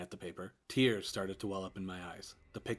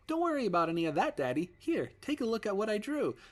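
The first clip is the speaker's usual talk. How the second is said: put-on high, quick little boy; a child eager to show a drawing; sweet child voice; 13 semitones higher, 5 dB louder than usual. Ghost son coaxing daddy, high and sweet.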